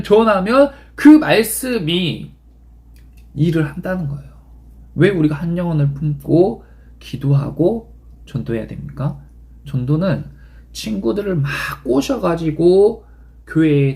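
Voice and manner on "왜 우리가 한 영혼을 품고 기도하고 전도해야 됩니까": usual speaking voice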